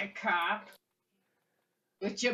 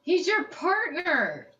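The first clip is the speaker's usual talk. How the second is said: angry; 9 semitones higher, 6 dB louder than usual. Acted. Interrogating the captive.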